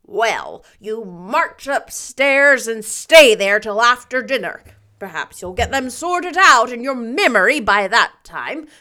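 loud, firm command; stern order. A narrator's reading. Marilla orders Davy upstairs, loud and stern. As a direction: send the boy upstairs.

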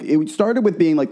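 normal talking voice

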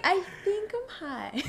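high, soft, slow imitation of being stoned; mock dazed stoner. Storyteller stares at scenery from the car, mock dazed realization. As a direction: draw it out in a small, high mock voice.

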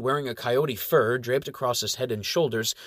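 ordinary delivery

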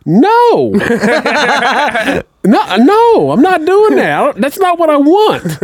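high, loud, panicky no, no; mock high refusal. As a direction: refuse it like it's poison. Retold refusing the pill.

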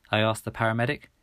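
usual speaking voice

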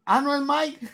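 put-on high, puzzled kid voice; high child voice. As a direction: ask it like a confused toddler. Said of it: "Y yo".

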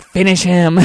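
loud, booming game announcer call; Mortal Kombat announcer. Retold or imitated. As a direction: announce the final blow. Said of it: "it was like Mortal Kombat".

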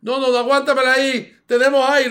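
loud, high retold call across the stage; host calls to the dancer in delight, loud; excited call out. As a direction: call out over the crowd, thrilled.

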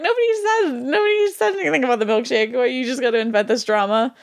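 high-pitched protest, easing down to normal; mock indignation. Host retelling her reaction to film drama, mock indignant. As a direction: exclaim it in exaggerated exasperation, starting high.

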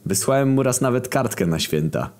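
usual speaking voice

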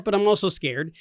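ordinary delivery

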